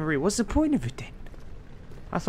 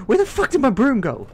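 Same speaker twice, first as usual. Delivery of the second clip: frantic yell; 9 semitones higher, 7 dB louder than usual. Real, not acted. Lost his broom in game.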